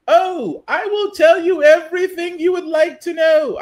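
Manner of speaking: high, loud mock English accent. Commentator mimicking Miss Maxwell offering to tell everything, mock English accent. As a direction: put on a hammed-up English accent. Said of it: "why I gave her an English accent".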